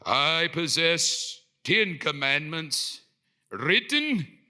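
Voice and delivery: booming, very slow proclamation; Moses. Acted. Leader back from the mountain addressing his people, grand booming proclamation. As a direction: proclaim it grandly, stretching every word. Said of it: "I don't know if that's how he sounds".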